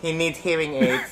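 low proverb in a put-on Chinese accent; mock Chinese accent. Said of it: "my name is Doctor Wong".

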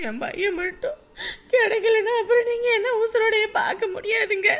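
high-pitched tearful wail; weeping, voice climbing high; crying. Acted. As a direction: sob out a desperate warning. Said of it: "சும்மா அப்படி அழுகுறதுனால".

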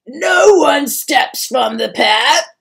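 loud, slow shouted command; crying out. A narrator's reading. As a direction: bellow it as a stern troll. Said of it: "cried another".

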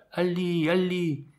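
high-pitched drawn-out call from afar; hailing someone from up in a tree. A narrator's reading. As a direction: call the name out high and long. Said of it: "lui cria".

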